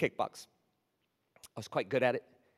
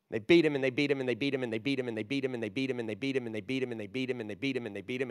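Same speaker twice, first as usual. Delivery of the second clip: fading refrain; 4 semitones lower, faster than usual. Acted. Preacher's repeated refrain, fading.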